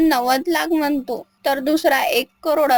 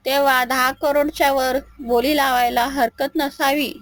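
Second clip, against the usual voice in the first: ordinary delivery, nothing marked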